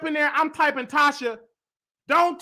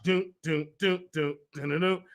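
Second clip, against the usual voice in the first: mock drumbeat; 8 semitones lower, 6 dB quieter than usual. Imitating marching drum beat.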